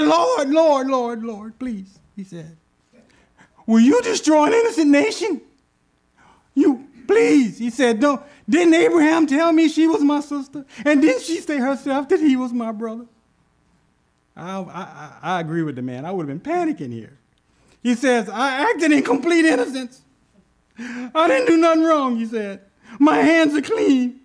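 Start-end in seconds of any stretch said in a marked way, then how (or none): 0.0-13.1 high, loud, begging voice; panicked pleading. Acted. King begs God in panic, high and loud. 18.3-24.2 high, loud insisting innocence; frantic protest. Acted. Terrified king swears innocence to God, high and loud.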